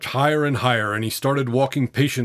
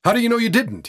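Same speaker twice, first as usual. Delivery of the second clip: high, sharply pressed question; sharp challenge; 10 semitones higher, faster than usual. A narrator's reading. Boy questioning the tramp's survival, sharp. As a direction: challenge him, pitch jumping up. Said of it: "The boy looked at him sharply".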